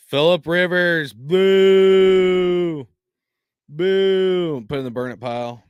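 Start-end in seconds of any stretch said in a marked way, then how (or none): 1.1-4.7 loud booing; mock booing. Real, not acted. Fan booing a rival quarterback's card, a loud mock boo. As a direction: boo him like a fan in the stands.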